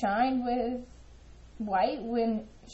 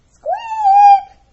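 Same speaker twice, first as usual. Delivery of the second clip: very high, very loud squeak; a piercing, shrill bird squeak; bird squawk; 17 dB louder than usual. Acted. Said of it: "squawked Lucille".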